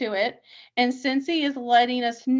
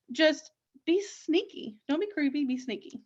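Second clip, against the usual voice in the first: ordinary delivery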